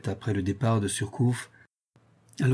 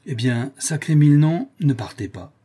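sailor's oath; 5 semitones higher, 5 dB louder than usual. Acted. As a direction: burst out with a louder, higher oath, then drop to urging. Sailor swears, urging him not to go. (juron de matelot lancé plus fort, puis supplication)

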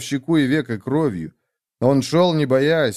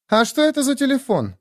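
put-on high-pitched young woman's question; curious Nastya; 13 semitones higher than usual. Nastya asks about the talking device, curious.

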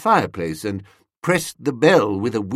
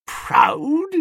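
high, rising, disbelieving echo; incredulous; 3 dB louder than usual. A narrator's reading. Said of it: "I detected an incredulous note".

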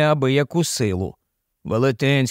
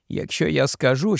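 plain reading voice

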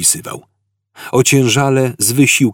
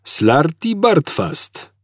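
slow, gentle repeat; 9 semitones higher, much slower than usual. A narrator's reading. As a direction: patiently say it again. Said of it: "powtórzył łagodnie stary".

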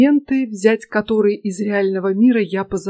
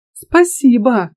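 slow, louder mock gratitude; ironic thanks; 3 semitones higher, 5 dB louder, slower than usual. A narrator's reading. Student thanking the intruder for her promise, drawn-out irony. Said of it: "иронично отозвался я".

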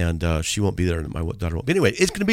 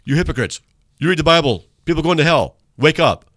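higher, louder sarcastic rant; mocking the writer; 7 semitones higher, 6 dB louder than usual. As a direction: parody the angry emailer. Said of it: "That doesn't make any sense".

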